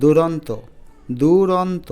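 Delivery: দুরন্ত said loud and deliberate, syllable by syllable; careful pronunciation demo. Shown as a demonstration. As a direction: enunciate দুরন্ত clearly for learners. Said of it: "এবার এটার উচ্চারণ দেখো".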